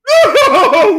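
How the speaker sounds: high, loud laugh; loud burst of laughter. Real, not acted.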